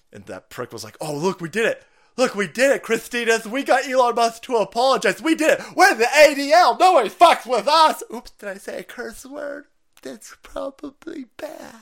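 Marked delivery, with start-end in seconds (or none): 1.0-8.0 high, loud gloating celebration; mock triumph. Imitating gloating ADL employee. 8.1-11.7 high, soft little oops; imitated goody-goody, slowing down; meek mock regret. Imitated prissy worry after cursing.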